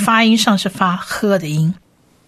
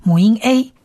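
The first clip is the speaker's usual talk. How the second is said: usual speaking voice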